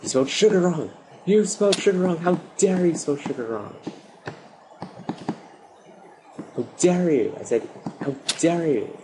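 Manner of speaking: high, hammed-up indignation; mock fury at a misspelled item; mock outrage. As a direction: scold the game like an offended diva.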